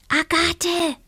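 high little witch voice. Acted. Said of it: "ertönte ein Stimmchen".

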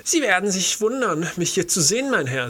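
put-on high, croaky old bookseller voice; croaking old man. A narrator's reading. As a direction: creak it out like a stooped old bookseller. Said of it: "sagte er mit eigentümlicher, krächzender Stimme".

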